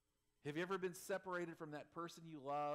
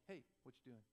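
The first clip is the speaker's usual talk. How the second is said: unfazed dog; 4 semitones lower, 9 dB quieter, faster than usual. Retold or imitated. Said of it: "and he was like".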